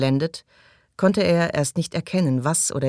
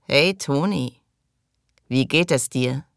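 croaking. A narrator's reading. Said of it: "krächzte".

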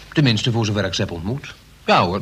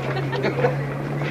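high breathless giggle; nervous giggle; 12 semitones higher, 4 dB quieter than usual. Acted. Nervous about hidden cargo. (zenuwachtig giechelen)

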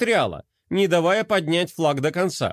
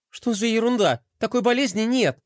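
higher, dismissive scoff; 7 semitones higher than usual. A narrator's reading. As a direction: brush off the nonsense. Gloria scoffs. (пренебрежительно, с насмешкой)

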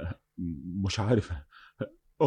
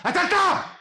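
frenzied shout. Acted. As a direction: scream the murder out. Confessing the murder in a frenzy. (صرخة قاتل هايج)

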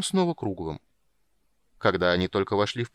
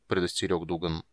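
usual speaking voice

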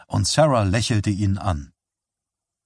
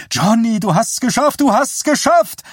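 very high, loud jubilant shout; excited cry; 13 semitones higher, 5 dB louder than usual. A narrator's reading. Sarah to Johnny as he wins, excited cry. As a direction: cry it out in triumph, climbing higher. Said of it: "rief Sarah".

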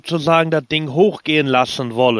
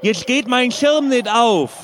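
mock panic; 7 semitones higher than usual. Parachutist prank call continues.

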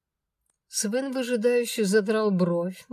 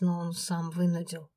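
plain reading voice

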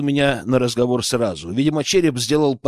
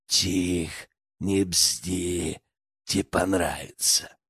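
low, hushed, menacing leer; creepy villain; 9 semitones lower, 8 dB quieter, much slower than usual. A narrator's reading. Poacher groping a frightened captive in darkness, creepy and low. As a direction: hush the victim in a low, sinister voice.

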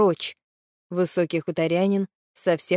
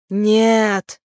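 loud, drawn-out cry of refusal; shouted protest; 2 semitones higher, 5 dB louder than usual. A narrator's reading. One of the drow with a poisoned blade shouts. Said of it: "закричал один из них".

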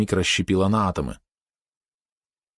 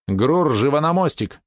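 higher, drawn-out summons; barked order; 7 semitones higher, slower than usual. A narrator's reading. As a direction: bark it over the comms, without shouting. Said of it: "рявкнула Мистерия по связи". (приказной окрик по связи)

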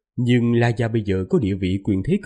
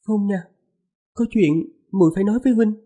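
higher, lightly voiced young wife; man doing a woman's voice; 9 semitones higher, faster than usual. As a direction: speak up high as the gentle wife. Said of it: "Lát giả giọng nữ".

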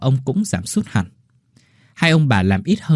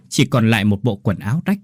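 plain reading voice

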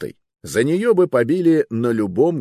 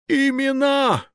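very high thundering command; booming demand; slower than usual. Acted. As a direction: bellow the demand. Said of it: "громогласно потребовал король".

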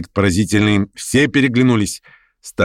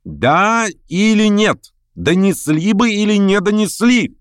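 high-pitched, drawn-out cry; shouted demand; 12 semitones higher, slower than usual. A narrator's reading. Verkhovensky demands the lame man's answer, crying out. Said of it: "крикнул Верховенский".

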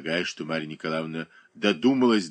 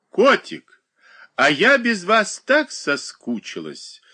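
high, loud and slow, gushing; gushing greeting, much higher and louder; exclaiming; 11 semitones higher, 5 dB louder, slower than usual. A narrator's reading. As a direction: exclaim it with a swooning, drawn-out delight. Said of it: "воскликнула Марья Николаевна".

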